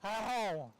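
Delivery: high calf bawl; bawling calf moo. Pretend. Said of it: "never heard a calf voice like that".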